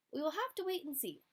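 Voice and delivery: high, quick little seed voice; a small plant promising patience. Acted. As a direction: chirp it out like a hopeful seed. Seed character voice.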